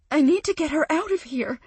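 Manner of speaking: high, loud and fast; urgent. Acted. Friend desperate to get her mother out, urgent. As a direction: push it out with urgency. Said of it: "Urgency coats her voice".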